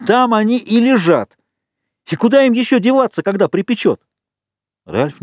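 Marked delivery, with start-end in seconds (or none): 0.1-4.0 high-pitched, eager outburst; thrilled hunter spotting his prey; jubilant excitement. A narrator's reading. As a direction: crow it triumphantly, pitch way up. Jubilant Jack spots the pigs.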